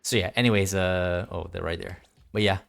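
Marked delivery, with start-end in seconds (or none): none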